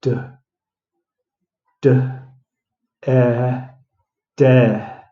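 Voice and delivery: "D, d, e, de" broken into separate sounds; sounding out death. Shown as a demonstration. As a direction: take death apart sound by sound. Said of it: "how many sounds do I have to make there".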